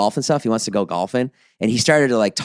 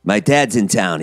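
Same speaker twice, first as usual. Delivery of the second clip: normal talking voice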